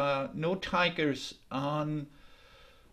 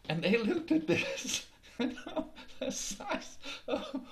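laughing narration; 2 semitones higher than usual. A narrator's reading. Narrator laughs at tigers' fright.

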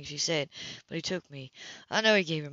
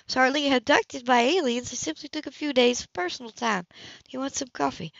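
higher, measured and cool woman's voice; Anna coolly justifying her absence to Cam, higher voice; Anna's cool voice; 7 semitones higher, 5 dB louder than usual. Acted. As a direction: keep her composed and a little icy.

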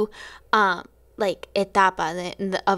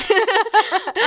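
high laugh; 11 semitones higher than usual. Real, not acted. Laughs at own joke.

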